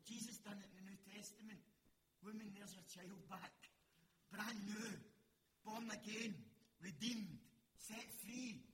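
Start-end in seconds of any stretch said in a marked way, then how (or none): 4.3-8.7 loud punchy declarations; proclaiming. Real, not acted. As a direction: hammer each word home from the pulpit. Preaching climax, proclaiming salvation.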